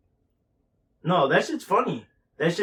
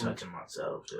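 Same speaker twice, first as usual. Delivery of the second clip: unvoiced whispered aside; whispered joke; 3 semitones lower, 11 dB quieter than usual. Real, not acted. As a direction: whisper it slyly.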